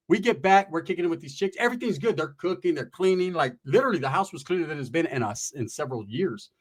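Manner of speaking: ordinary talk, nothing marked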